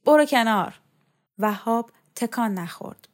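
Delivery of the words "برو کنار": loud, higher, sharp command; sudden raised-voice order; shouting. A narrator's reading. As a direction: yell at someone in the way. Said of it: "شوکت داد کشید".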